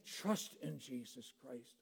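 soft, slow and low; low, hushed invitation; quiet plea. Acted. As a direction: murmur the call tenderly. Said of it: "God is saying".

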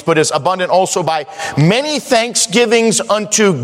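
high, ringing scripture delivery; preaching. Real, not acted. Preacher declaring God is able, high emphatic preaching. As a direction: preach it out, stretching the key words. Preaching verse at full force.

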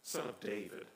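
soft, low "Son of David" as the key phrase; quiet repeat. Shown as a demonstration. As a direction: say "Son of David" softly to let it land. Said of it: "did you hear that phrase".

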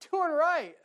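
high, loud outcry; emphatic exclaim. Real, not acted. Preacher crying out the answer, high and loud. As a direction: throw it out to the crowd.

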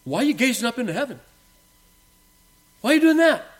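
rising high, incredulous challenge; angel's question. Retold or imitated. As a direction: ask it like the angel at the ascension, pitch climbing. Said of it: "And the angel says".

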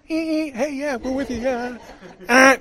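high, loud mock cheer; cheerful honker. Shown as a demonstration. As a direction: cheer them on like a squeaky fan. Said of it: "you wanna encourage somebody".

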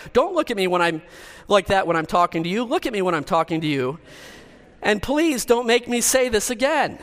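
hammed-up demand for attention, slowing on the last line; ranting parent. Preacher ends the parents' sayings, mock exasperated demand. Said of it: "Seventy Sayings of a Parent".

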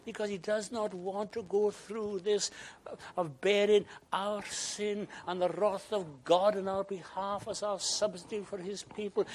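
high, loud, driving sermon voice; loud and high impassioned preaching; full-force preaching. Acted.

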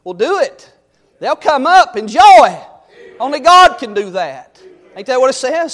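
loud, high preaching cadence; fiery preaching. Acted. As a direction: preach it out to the pews. Preacher building to full voice.

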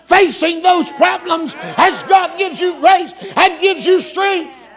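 high, loud, slow preaching climax; full-force preaching. Real, not acted. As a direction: preach it home with fire.

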